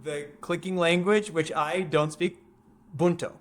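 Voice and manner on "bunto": "Bunto" as two plain syllables, no click; simplified name without click. Shown as a demonstration. Giving the audience the crew's nickname, "Bunto" without clicks. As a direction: say "Bunto" the English way, BUN-toe. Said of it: "they called him Bunto".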